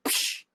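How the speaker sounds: unvoiced swish; whooshing sound effect. A hushed whoosh as the wife turns to him. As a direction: breathe out a quick whoosh for the foot. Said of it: "locks onto her hip".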